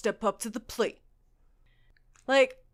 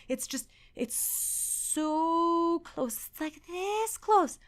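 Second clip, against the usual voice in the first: tiny high voice, drawn out; high squeaky emphasis; 6 semitones higher, 3 dB quieter, much slower than usual. Real, not acted.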